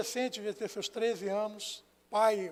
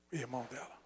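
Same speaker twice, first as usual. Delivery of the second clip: near whisper; 9 semitones lower, 11 dB quieter, faster than usual. Real, not acted. Repeating the reveal.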